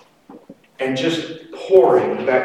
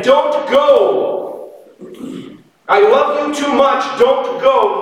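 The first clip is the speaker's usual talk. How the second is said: pleading father; 10 semitones higher, slower than usual. Pretend. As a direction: call out don't go like a father who can't let go. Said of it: "the Father isn't standing ... saying".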